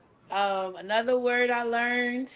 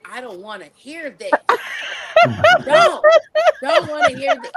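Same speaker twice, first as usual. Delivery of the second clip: exasperated; 14 semitones higher, 8 dB louder than usual. Retold or imitated. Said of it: "I'm just like".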